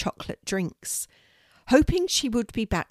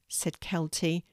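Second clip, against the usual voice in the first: usual speaking voice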